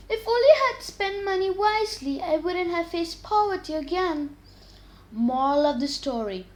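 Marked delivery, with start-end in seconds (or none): 0.1-4.4 higher, mild lament; regretful. A narrator's reading. George regretting his wasted money, mild lament. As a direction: sigh over the lost chance, lightly voiced. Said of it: "George regretted".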